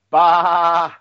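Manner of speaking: normal talking voice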